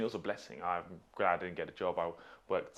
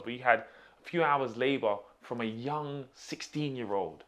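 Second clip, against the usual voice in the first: raised, high-pitched grievance; indignant complaint; 5 semitones higher, 6 dB louder than usual. Real, not acted. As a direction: protest the unfair deal, pushing each point. Complaining to employer about unpaid trial.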